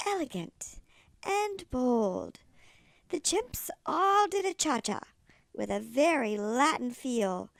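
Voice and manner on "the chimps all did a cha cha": usual speaking voice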